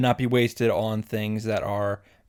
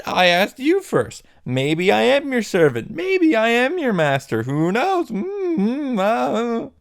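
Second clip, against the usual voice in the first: back-and-forth squabble, climbing steadily higher; childish who's-who argument, pitched up by the end; mock bickering; 5 semitones higher than usual. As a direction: bicker with yourself, getting higher and more exasperated. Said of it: "goes as follows".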